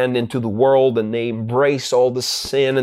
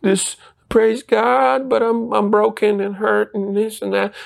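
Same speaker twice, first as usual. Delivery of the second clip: high-pitched mock-meek believer; imitating a meek, broken believer; mock weak, meek; 8 semitones higher than usual. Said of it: "It's not about, "Well, just be weak, meek".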